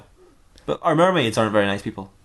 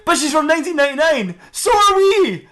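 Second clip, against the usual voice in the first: very high comic outburst, ending louder; exasperated argument; 4 dB louder than usual. Acted. Police arguing over the mermaid's origin, exasperated. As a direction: build to a hammed-up squeal of protest. Officers and detective argue.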